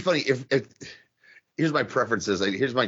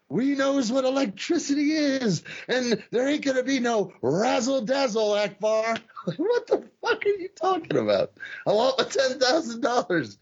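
much higher, dazed, rambling promo voice; mocking a punch-drunk wrestler cutting a promo; Kerry impression; 10 semitones higher than usual. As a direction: ham up a confused wrestler's promo. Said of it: "He goes".

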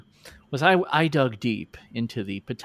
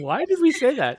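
high-pitched mock dismay; mock regret; 10 semitones higher than usual. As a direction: echo the regret with raised pitch. Echoes guest's regret, acted.

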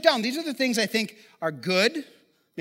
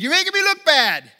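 raised, louder mock yell at a passing runner; retold yell; 6 semitones higher, 5 dB louder than usual. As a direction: call out after the kid, half-joking. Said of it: "I yelled at him".

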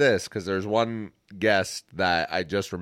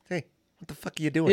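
higher, quick Boston-accent impression; 6 semitones higher, faster than usual. Co-host redoing the impression of Dave, Boston accent. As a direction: do the friend's Boston accent, fast. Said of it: "go ahead and do it for me".

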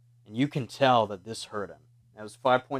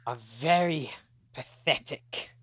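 lightly voiced vampire. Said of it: "he looks at you and goes".

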